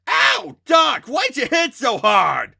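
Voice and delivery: high, loud pained shout. A narrator's reading. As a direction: yell it out in pain. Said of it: "and shouted".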